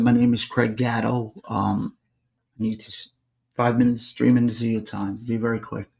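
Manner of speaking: very soft, understated introduction; soft spoken. Retold or imitated. Visitor asking Anthony for a few minutes, very soft. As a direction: keep it quiet and unthreatening. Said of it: "Soft spoken".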